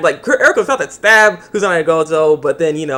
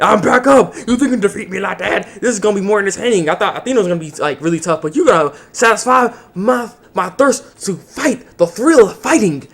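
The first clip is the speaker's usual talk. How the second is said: higher, hammed-up thrill-seeking taunt; gleeful battle villain; 4 semitones higher than usual. Villain taunting the hero, hammed-up gleeful voice. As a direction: gloat like a fighter who loves the fight. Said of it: "But, like".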